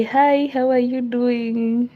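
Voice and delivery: usual speaking voice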